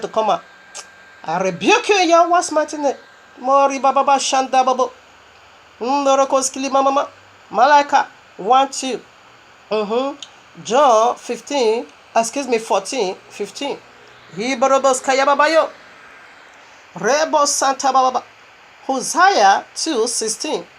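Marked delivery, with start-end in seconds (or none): 3.4-7.1 speaking in tongues. Real, not acted. 14.3-18.2 speaking in tongues. Real, not acted.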